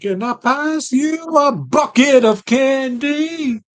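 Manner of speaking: slow, high mocking echo. Mocking exaggerated repeat.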